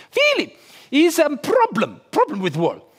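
high Polish builder, worried; Polish accent. Retold or imitated. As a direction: Polish builder reporting a problem. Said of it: "he's got a very strange accent".

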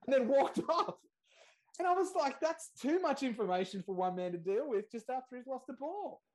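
laughing, overwhelmed punchline; exasperated disbelief. Real, not acted. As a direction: throw up your hands at the absurdity. Exasperated punchline about the nurse.

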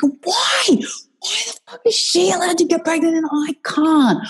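high, strained, tearful cry of rage; retold angry outburst. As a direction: throw the unfair question at the sky, voice cracking. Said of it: "crazy woman yelling at pregnant people".

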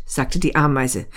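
ordinary delivery, nothing marked

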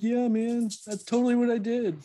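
high-pitched fake voice; thrown voice. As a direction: throw your voice as someone else. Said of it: "like, throw my voice".

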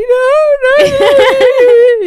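very high, loud mock child wail; a little boy near tears protesting; whining child cry. Little boy protesting to his grandma, wailing.

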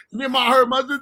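usual speaking voice

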